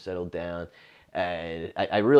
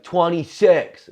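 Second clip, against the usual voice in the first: high, loud, slow call-out; girl shouting her age; 11 semitones higher, 12 dB louder, slower than usual. Retold or imitated. Drunk girl corrects the comic, high slow shout. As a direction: bellow the number like a proud drunk.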